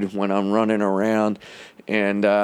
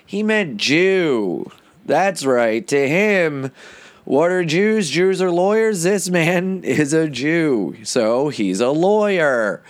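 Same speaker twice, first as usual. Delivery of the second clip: indignant rant; 6 semitones higher, slower than usual. Retold or imitated. Indignant retold inner rant.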